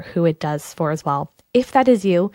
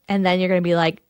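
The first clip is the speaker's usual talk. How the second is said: normal talking voice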